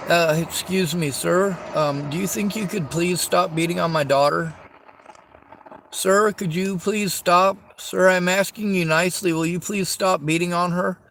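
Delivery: overly polite begging, rising to desperate; mock polite. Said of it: "No, that's not how you're gonna fucking react".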